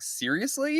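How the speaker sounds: mock disbelief. Prank victim voiced, high squeak.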